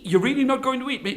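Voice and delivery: high, fast anxious question; nervous Brahmin. Acted. Brahmin opening the cage door, nervous. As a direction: blurt it out high and quick.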